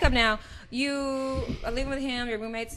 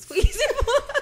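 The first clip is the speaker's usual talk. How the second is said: laughing; 13 semitones higher, 7 dB louder than usual. Real, not acted. Insisting through laughter.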